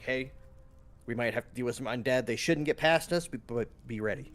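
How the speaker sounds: ordinary talk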